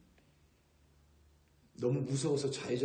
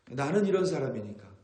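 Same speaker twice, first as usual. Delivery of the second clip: louder, higher stubborn "I'm just like this"; stubborn self-justifying claim, played louder; mock self-centred insistence; 3 semitones higher, 7 dB louder than usual. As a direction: insist like a selfish person, a bit hammed up. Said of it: "라고 말하는 것도 자기중심적인".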